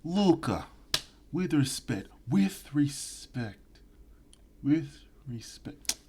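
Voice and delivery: high, slow fading to a whisper; menacing gangster fading to a hush; Luca impression. Imitating the Peaky Blinders Italian mobster, fading to a whisper. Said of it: "that Italian guy on Peaky Blinders".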